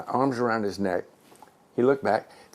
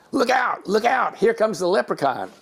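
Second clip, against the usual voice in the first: much higher retold crowd warning; warning shout; 12 semitones higher than usual. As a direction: call out the alarm across the stands. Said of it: "I heard somebody say".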